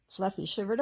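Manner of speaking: ordinary delivery, nothing marked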